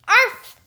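high, loud yappy dog bark; excited bark. Acted. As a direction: bark like an eager little dog. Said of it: "Fifi barked excitedly".

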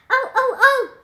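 bursting with a new idea; excited oh oh. Acted. Emerson excitedly calls out to the girls, high and loud.

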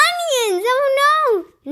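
high-pitched acted cry of dismay; alarmed shout. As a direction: shout it high, in storybook panic. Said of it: "Nick shouted".

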